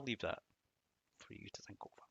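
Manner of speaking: hushed. Real, not acted. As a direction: drop almost to a whisper. Leaving a question to ponder.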